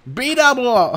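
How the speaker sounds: failed B double O voice. Shown as a demonstration. Failed catchphrase voice, admitted right after. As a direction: try the catchphrase voice and miss. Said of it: "I can't do a B double O voice".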